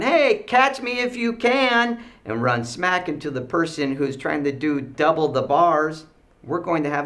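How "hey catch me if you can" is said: put-on high, loud, drawn-out kid taunt; playful yelling. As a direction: imitate a kid yelling in play. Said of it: "comes running around yelling".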